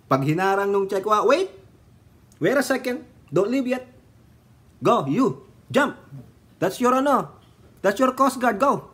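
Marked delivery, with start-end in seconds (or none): 1.0-3.9 mock urgent calling out. Acting out coast guard stop. 4.8-8.9 mock bossy order. Ordering citizens to jump.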